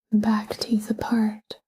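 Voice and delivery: ordinary talk, nothing marked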